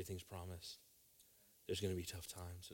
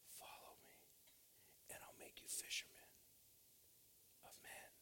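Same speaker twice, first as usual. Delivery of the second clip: whispered; 15 dB quieter than usual. Retold or imitated. Jesus quoted, soft whisper.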